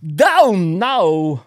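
drawn-out Walken don't know; Walken puzzled. Retold or imitated. Comic showing Walken baffled by the trick, drawn out. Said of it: "And then".

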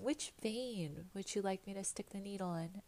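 softly imitated nurse's question; chit-chatty nurse. Imitating the nurse chatting before the IV, soft and casual. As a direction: toss it off quietly, as small talk. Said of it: "sitting there chit-chatting with you going".

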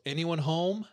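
calling out loud and high into an empty building; retold yell. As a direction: shout it down the basement stairs to anyone still there. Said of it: "he yells".

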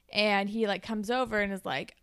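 ordinary talk, nothing marked

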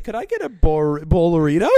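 loud, high customer order. Retold or imitated.

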